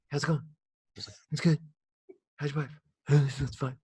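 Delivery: quiet, flat murmur; inside voice. Retold or imitated. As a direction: say it softly to yourself. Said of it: "talking to each other with their inside voice".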